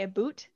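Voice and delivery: ABOUT said as "a boot", with an oo vowel. Retold or imitated. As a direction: say "a boot" for "about", rhyming with hoot. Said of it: "people think we say".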